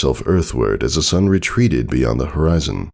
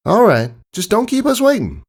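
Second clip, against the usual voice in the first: Applebloom's voice. Acted. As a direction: speak up high like a caring young pony.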